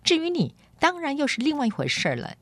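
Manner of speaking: haughty, indignant complaint, pitched high; mildly fierce storybook girl, voice swooping up; fierce retort. A narrator's reading. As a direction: complain crossly as a noble girl. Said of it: "但口气很凶".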